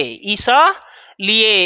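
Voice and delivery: "इसलिए" slowly as i-sa-li-e, the a sounded; correct pronunciation. Shown as a demonstration. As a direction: stretch "इसलिए" with a clear sa. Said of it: "ये सही उसका उच्चारण है".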